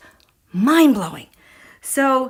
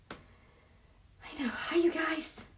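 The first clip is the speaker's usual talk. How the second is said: whispering; 6 semitones higher, 15 dB quieter, much slower than usual. Real, not acted. Muting, greeting viewers softly.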